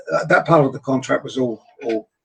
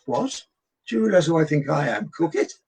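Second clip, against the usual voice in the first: a hammed-up diva who cannot believe it; mock outrage; 2 semitones higher than usual. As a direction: pitch leaps way up on the final cook it. Indignant at being told to cook.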